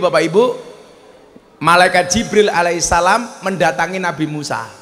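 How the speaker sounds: usual speaking voice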